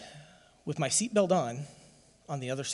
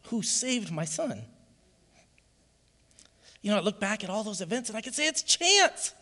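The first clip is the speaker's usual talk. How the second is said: high, loud, impassioned preacher's cry; full-force preaching; 7 semitones higher, 7 dB louder than usual. Real, not acted. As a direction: proclaim it to the church with everything you have.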